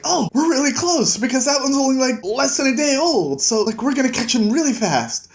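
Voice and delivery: lightly voiced excited young man; excited Kavoth. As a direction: bubble over with tracking excitement. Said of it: "Kavoth is really excited".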